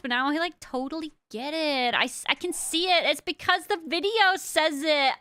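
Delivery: put-on valley girl, stretched line endings. Shown as a demonstration. Streamer keeps viewers on the video, hammed-up valley girl. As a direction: chatter like a valley girl, swooping on exclamations. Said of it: "also do the Valley Girl".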